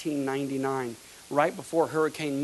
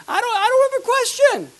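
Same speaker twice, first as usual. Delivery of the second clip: high cracking fervor; 9 dB louder than usual. Real, not acted.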